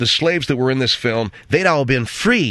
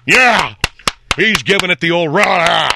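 loud whooping cheer; a loud rah from the seat; mock cheer; 5 semitones higher, 6 dB louder, slower than usual. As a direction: cheer like a fan in the theater. Moviegoer cheering at film end.

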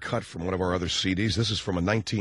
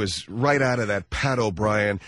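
normal talking voice

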